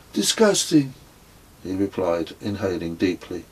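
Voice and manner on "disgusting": high, raised voice for Kez, pulling a face; a higher voiced friend, disgusted by the smoke; character voice. A narrator's reading. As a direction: squeak it out in disgust after a foul drag. Kez voiced after smoking.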